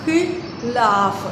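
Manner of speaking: खिलाफ said very slowly, syllable by syllable; slow drawn-out demo. Shown as a demonstration. Teacher drawing out खिलाफ slowly for children. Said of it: "तो लफ्ज़ है खिलाफ".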